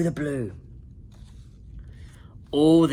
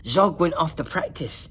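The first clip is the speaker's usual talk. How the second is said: ordinary delivery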